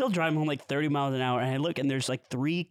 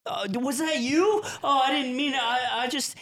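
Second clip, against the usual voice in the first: put-on scared kid; 9 semitones higher than usual. Caught kid pleading with the adult driver. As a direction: squeak it out like a caught child. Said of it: "They're fucking terrified".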